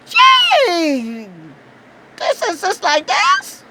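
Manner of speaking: high, slow, hushed amazement; breathless wow at the technology; awestruck wonder. Retold or imitated. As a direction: marvel like a wide-eyed tourist. Awe at FBI interior.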